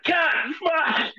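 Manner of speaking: high-pitched stubbed-toe swearing; sudden pained expletives, played for laughs; acted pain curse.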